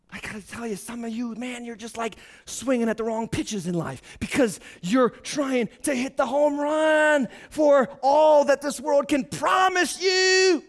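normal talking voice

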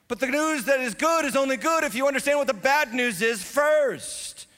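high, loud, driving sermon voice; preaching at full force. Acted. Pastor urging the congregation to give, preaching at full force. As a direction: preach it hard to the whole room.

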